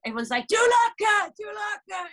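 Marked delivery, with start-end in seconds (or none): 0.5-1.3 high, loud furious demand; angry yell. Retold or imitated. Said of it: "he gets really pissed off". 1.4-2.1 high shout, dropping in level. Retold or imitated. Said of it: "he gets really pissed off".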